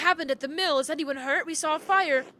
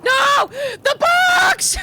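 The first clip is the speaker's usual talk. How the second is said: horrified shout; 12 semitones higher, 9 dB louder, much slower than usual. Acted. Priest sees books burning.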